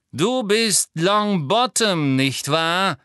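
high, slow mocking sneer; taunting villain drawl. A narrator's reading. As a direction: jeer at a weaker foe with false politeness. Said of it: "höhnte Lucius Malfoy".

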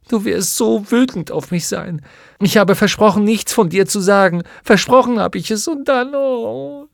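high, tearful confession breaking into a wail; fearful, ashamed crying; sobbing in shame. A narrator's reading. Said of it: "Sie schluchzte".

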